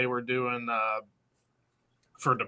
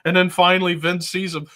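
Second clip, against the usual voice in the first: normal talking voice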